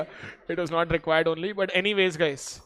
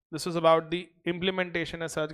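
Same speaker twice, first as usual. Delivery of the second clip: ordinary talk, nothing marked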